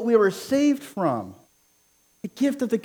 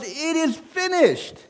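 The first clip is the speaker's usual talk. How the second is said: slow and high, drawn out; quoting Christ slowly and high; Jesus on the cross; 7 semitones higher, slower than usual. Acted. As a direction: give the dying words slowly, lifted high. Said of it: "Jesus said on the cross".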